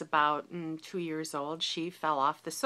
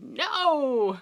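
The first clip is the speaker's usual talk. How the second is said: sharp loud NO, higher; retold shout; 7 semitones higher, 7 dB louder than usual. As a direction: shout it to stop her. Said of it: "And I shouted".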